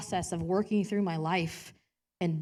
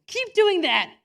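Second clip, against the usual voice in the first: delighted urging; 11 semitones higher, 9 dB louder, slower than usual. Retold or imitated. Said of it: "starts laughing hysterically".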